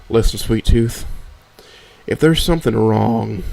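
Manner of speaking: usual speaking voice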